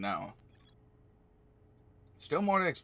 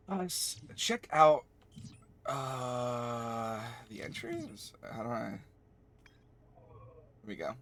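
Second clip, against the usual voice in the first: usual speaking voice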